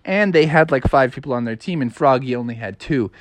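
ordinary delivery

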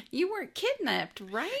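high-pitched mock question. Cop checking on the supposed kidnap victim, high mock questioning. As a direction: squeak it out as a leading question.

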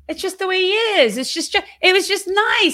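high, airy mimicry of excuse-makers; mocking dismissive people. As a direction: imitate people brushing it off in a high voice. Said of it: "so many people being like".

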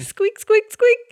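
high squeaky rat noises; rubbery high squeaky toy. Acted. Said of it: "I'm just gonna be squeaking".

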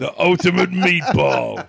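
slow booming announcer call. Retold or imitated. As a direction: boom it out like a ring announcer. Repeating film line, slow loud.

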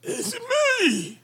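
put-on high, squeezed goblin accent; strained goblin voice. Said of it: "that accent and the drained voice".

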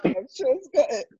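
high, still laughing; half laughing. Real, not acted. Joking about grooming, laughing.

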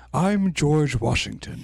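lightly put-on presidential voice; a hint of a stock historical-figure impression; George Washington. Joking that everyone agrees how Washington sounds, light impression. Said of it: "George Washington just sounds like".

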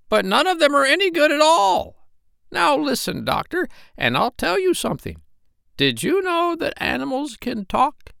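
very high, squawky bossy parrot. Acted. Parrot Polynesia scolding the doctor, very high bird voice. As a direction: peck at him with a shrill bird voice.

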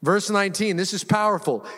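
ordinary talk, nothing marked

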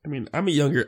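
normal talking voice

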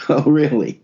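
high sarcastic "really"; mock surprise. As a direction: play the disbelief up high. Mock disbelief at the joke.

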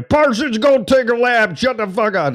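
high-pitched, exaggerated football coach holler; hammed-up yelled order; imitated coach yelling. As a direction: bellow it like a coach at practice. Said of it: "they yelled at you".

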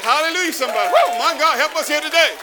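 high, loud, exultant preaching; fervent praise shout. Real, not acted. Preacher rousing the congregation to praise, fervent shout. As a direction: cry out praise at full force.

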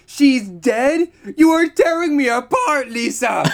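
Tommy Wiseau impression. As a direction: ham up the melodramatic anguish. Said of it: "I was like".

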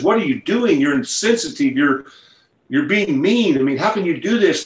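high-pitched imitated outrage; indignant. Man in the crowd berating the healer, indignant.

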